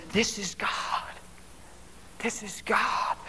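soft, slightly hushed, pausing between; hushed realisation. Retold or imitated. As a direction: let it sink in quietly. His hushed thought on hearing God.

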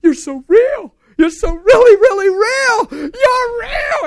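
very high, very loud cry; retold ecstatic scream. Preacher reenacting his conversion, screaming to God. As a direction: shriek it in overwhelmed joy, drawn out. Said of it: "screaming, jumping in circles, going".

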